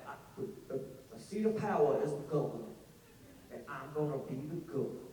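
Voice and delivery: low, soft Southern politician voice; mock Southern accent. Mocking a political drama's lead, low affected Southern accent. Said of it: "the accent made me crazy".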